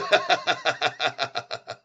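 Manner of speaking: long run of quick ha-ha-ha; amused laughing fit. Real, not acted. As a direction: chuckle helplessly, trailing away. Laughs after reading chat.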